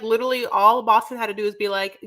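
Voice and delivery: normal talking voice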